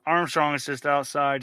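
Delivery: normal talking voice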